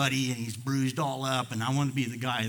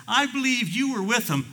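put-on high, louder little girl's accusation; accusing little girl; 12 semitones higher, 7 dB louder than usual. Preacher as little girl confronting Peter, accusing. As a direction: pipe up high, pointing him out. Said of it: "a little girl pops up".

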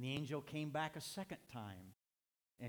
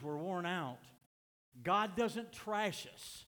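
slow, weighty lines building to a louder high peak; slow emphatic preaching; 4 semitones higher, 4 dB louder, slower than usual. Real, not acted. Preacher reassuring the congregation, slow and building. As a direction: lean on each line, then lift for the promise.

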